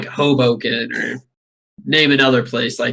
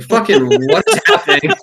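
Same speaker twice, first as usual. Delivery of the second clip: mock confused trucker; 12 semitones higher than usual. Trucker reacting to billboard.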